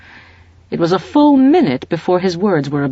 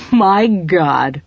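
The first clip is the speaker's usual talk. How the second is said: louder gasping exclamation; gasped; 3 semitones higher, 6 dB louder than usual. Acted. Farrell to the colonel, gasping in shock. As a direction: caught by a sudden realisation. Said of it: "he gasped".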